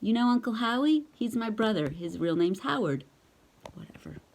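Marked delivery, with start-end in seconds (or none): 3.7-4.2 breathy throwaway whisper; whispered aside. Real, not acted. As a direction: toss it off under your breath. Dismissive aside.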